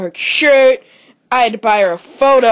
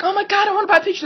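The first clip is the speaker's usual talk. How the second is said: mock excited girl; 11 semitones higher, much faster than usual. Retelling his friend's excited request.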